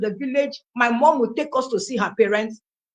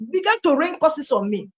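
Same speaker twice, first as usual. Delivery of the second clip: ordinary talk, nothing marked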